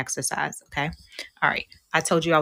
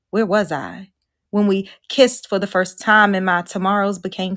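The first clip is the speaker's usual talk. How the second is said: louder, measured spoken-word recital; poem reading; 3 semitones higher, 5 dB louder than usual. Acted. Speaker reading her own poem to the retreat audience, spoken-word style. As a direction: perform the poem with weight and rise.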